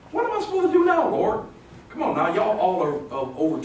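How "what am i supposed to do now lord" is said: high-pitched imploring question to the Lord; mock-helpless high plea; acted plea. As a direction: plead it up high, as a puzzled believer. Voicing a churchgoer asking God.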